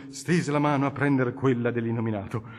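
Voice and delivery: plain reading voice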